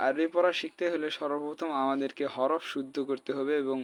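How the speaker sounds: ordinary talk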